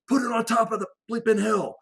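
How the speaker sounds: high, strained shout of a man being overrun; desperate radio yell. Retold or imitated. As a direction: yell it like your life depends on it. Desperate JTAC demands bomb on himself.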